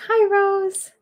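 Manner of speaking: high sweet greeting to a spirit. Real, not acted. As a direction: sing out the hello like greeting a friend.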